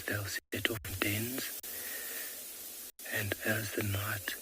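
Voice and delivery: ordinary delivery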